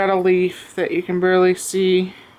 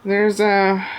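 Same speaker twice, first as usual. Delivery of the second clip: out of breath; much slower than usual. Real, not acted. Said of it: "I always sound so out of breath when I film".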